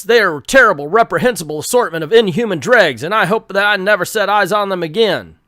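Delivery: an army general laying down orders; gruff general. Acted. General briefing the commandos.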